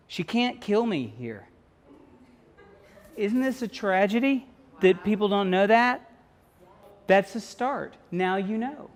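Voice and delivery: usual speaking voice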